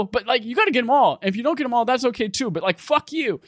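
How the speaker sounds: very high, excitable squawking game character; Trover. Acted. Game character congratulates player for the power baby, manic high voice. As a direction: rant in a pitched-way-up cartoon voice. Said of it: "and then Trevor's like".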